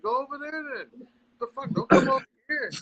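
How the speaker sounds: hammed-up scolding of complainers, rising high; mock rant. Florida guy mocking complainers, voice climbing very high. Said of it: "I'm like".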